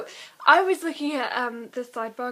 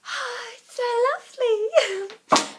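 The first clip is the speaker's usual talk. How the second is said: much higher, airy and weird; a dreamy, much higher airy voice; Riss voice; 13 semitones higher, 6 dB louder than usual. Retold or imitated. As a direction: float along dreamily in awe. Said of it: "she'd be like".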